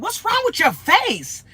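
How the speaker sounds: high, slow, drawn-out heckle; hammed-up slow mock disgust; mock disgusted questioning. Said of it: "this other person came on".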